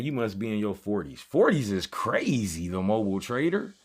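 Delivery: ordinary talk, nothing marked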